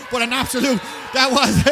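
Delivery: high, loud, shocked exclamation; surprised outburst. Real, not acted. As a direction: react in disbelief to the hit. Commentator reacts to a hit.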